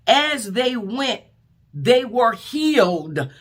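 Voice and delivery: high, drawn-out preacher repeat; slow, ringing pulpit repetition; slow preaching emphasis. Acted. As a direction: stretch each word out like a proclamation. Repeated slower, higher.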